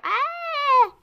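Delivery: long high-pitched shriek; drawn-out startled squeal; high falling scream. Acted. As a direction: scream as if tumbling over. Character stumbles while hurrying over.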